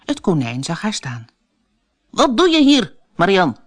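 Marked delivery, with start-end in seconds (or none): 2.1-3.6 loud, cross rabbit snapping at the maid; indignant, raised-voice demand; angry call. A narrator's reading. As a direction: call out sternly, high and loud, falling on the name. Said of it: "riep hij boos".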